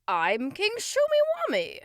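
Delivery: high, booming king's proclamation; grand king. Acted. King answers the boy from behind, grandly booming. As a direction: announce yourself loudly like a storybook king.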